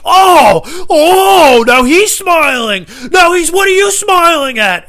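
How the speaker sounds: loud, high, drawn-out mock indignation; screaming outrage. Customer spotting a smile across the room, screaming outrage. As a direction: imitate an incensed man yelling across a room. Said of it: "screaming at each other".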